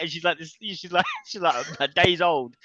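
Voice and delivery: ordinary talk, nothing marked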